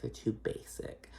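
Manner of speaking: whispered, slow catty repeat; whispered echo. Shown as a demonstration. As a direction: hiss it again as a catty aside. Whispered echo of own line.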